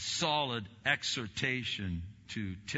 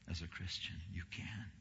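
whisper; 2 semitones lower, 7 dB quieter than usual. Real, not acted. Quiet emphatic reassurance.

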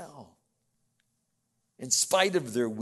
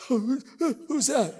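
high, playful voice with a laugh; baby-talk voice; 12 semitones higher, 6 dB louder than usual. Shown as a demonstration. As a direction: coo a question at a little child. Demonstrates talking to a little child.

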